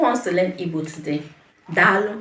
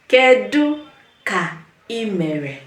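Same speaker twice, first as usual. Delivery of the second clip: "Kedu ka ị mere" slow, with pauses between words; slow word-by-word model; 2 semitones higher than usual. Shown as a demonstration. Teacher has learners repeat after her, "Kedu ka ị mere" slowly, piece by piece. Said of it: "Say it with me".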